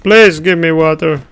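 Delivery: loud desperate plea; begging cry. Acted. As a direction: beg loudly for water. Begging for water.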